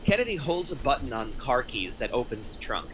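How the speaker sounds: ordinary delivery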